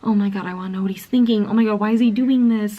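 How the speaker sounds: fast, breathless rush of questions; frantic Bella. Retold or imitated. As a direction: gush it out in a hurry like an anxious reader. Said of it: "and just being like".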